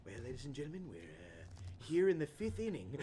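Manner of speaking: mock ballgame announcer, dropping low and soft; deep announcer voice. Alone at the plaza mic, mock deep announcer. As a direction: ham up a lonely stadium announcer. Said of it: "could you keep that voice up all stream long".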